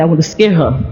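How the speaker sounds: ordinary delivery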